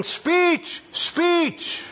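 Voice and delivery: high-pitched party crowd chanting Speech; mock crowd yell. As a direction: call it out like a cheering crowd. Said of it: "the people started yelling".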